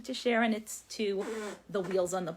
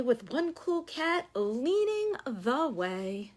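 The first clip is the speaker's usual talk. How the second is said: lively sing-song read-aloud; story reading to children; 2 semitones higher, 4 dB louder than usual. Acted. Teacher reading a picture book to the class. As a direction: read it like story time for little ones.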